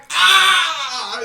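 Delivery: loud, high creature roar; monster yell. Retold or imitated. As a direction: roar at the hat. Thing yelling at the hat.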